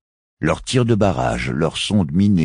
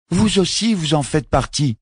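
much higher, the voice shooting up on a cry; cry of surprise; 13 semitones higher than usual. A narrator's reading. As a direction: burst out, the child seeing the truth. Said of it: "s'écria Jek". (Jek s'écrie, voix très aiguë et vive)

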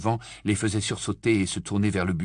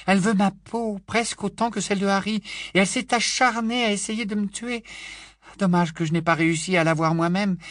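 put-on raised, enthusiastic young woman; Tonk's voice; 8 semitones higher than usual. Tonk praises Ron to Hermione, raised and eager. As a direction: praise Ron with gusto, pitched up.

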